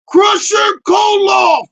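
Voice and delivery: high, loud, slow ring-announcer call; announcer shout. Acted. Introducing wrestler Crusher Koloff like a ring announcer, slow and loud. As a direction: boom the name out to the arena.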